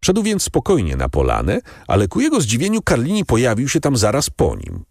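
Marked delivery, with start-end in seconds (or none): none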